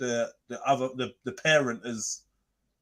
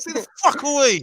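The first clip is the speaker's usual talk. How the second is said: angry; 6 semitones higher than usual. Pretend. Role-play ex shouting.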